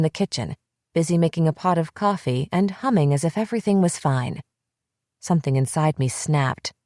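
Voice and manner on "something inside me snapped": ordinary delivery, nothing marked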